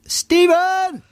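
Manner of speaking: high long call of Stephen!; shouted name. Acted. Cohost finishing the joke, long high shout. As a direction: call it out across a crowd.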